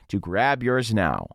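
ordinary talk, nothing marked

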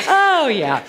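high, laughing words. Real, not acted. Speaker recovering from laughing at herself, laughing. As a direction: talk through the tail of a cackle. Recovering from her own cackling.

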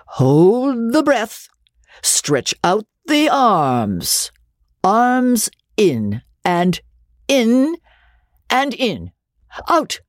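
slow, high coaching voice; teacher's commands. A narrator's reading. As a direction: call out each step slowly like a coach. Teacher leading breathing exercise.

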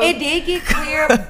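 slow, drawn-out laughing repeat; laughing slowly. Real, not acted. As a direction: stretch it out through laughter.

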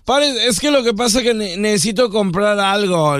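high-pitched, put-on begging; arrested man pleading. Man in patrol car begging police to stop, high plea. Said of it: "cuando ya andaba allá atrás arrestado".

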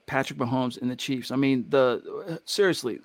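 normal talking voice

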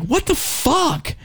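high, slow, drawn-out disbelief; shocked reaction. Retold or imitated. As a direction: stretch it out in mock shock. Friend reacting to dick pic.

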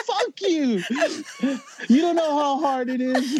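high mock rage with a laugh in it; retold angry snap. To his watching parents, a laughing mock fury. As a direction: throw it at them like a sulking teen. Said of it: "hella pissed off".

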